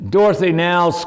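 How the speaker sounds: ordinary delivery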